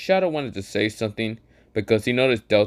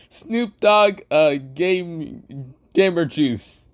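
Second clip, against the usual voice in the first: Gojo voice; 7 semitones higher, much slower than usual. Acted. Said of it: "This is where Gojo says".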